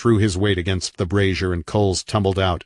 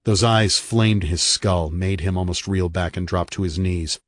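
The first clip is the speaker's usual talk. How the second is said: usual speaking voice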